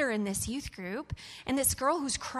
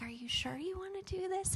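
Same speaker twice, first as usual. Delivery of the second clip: high, softened, careful question; gentle concerned check; 9 semitones higher, 4 dB quieter than usual. Retold or imitated. Pastor asking a young volunteer, gentle concern. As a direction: ask it tenderly, pitched well up.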